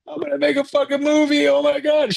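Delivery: imitating a tearful high-pitched panic; terrified, crying. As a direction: squeal it like you're crying to your wife, scared. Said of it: "why do you sound all terrified".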